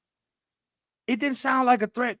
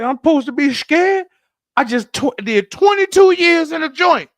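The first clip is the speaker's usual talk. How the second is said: loud, high boasting; tough threat; 9 semitones higher, 11 dB louder than usual. Retold or imitated. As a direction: brag loudly to scare him. Retelling threatening ex on phone.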